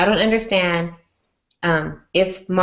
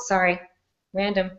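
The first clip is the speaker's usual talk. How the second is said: normal talking voice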